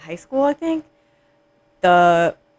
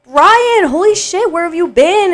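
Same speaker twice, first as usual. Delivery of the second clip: very high, loud re-enacted greeting; excited greeting; 11 semitones higher, 6 dB louder, slower than usual. Friend insisting how warmly she greeted him, excited greeting. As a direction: squeal it like a long-lost friend. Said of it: "because I was like".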